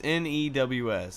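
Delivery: N E W S spelled letter by letter. Shown as a demonstration. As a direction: spell out N E W S slowly. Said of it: "spelling it out".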